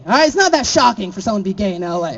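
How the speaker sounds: ordinary talk, nothing marked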